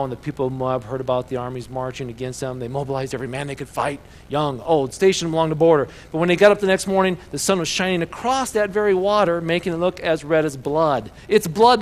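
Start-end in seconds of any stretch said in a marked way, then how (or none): 4.3-11.1 loud storytelling, rising in pitch; dramatic scripture reading. A narrator's reading. Reads the Bible story aloud. 11.3-11.8 loud, high exclamation; crying out. A narrator's reading. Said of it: "they cried, the Moabites exclaimed".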